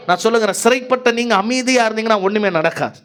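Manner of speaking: ordinary talk, nothing marked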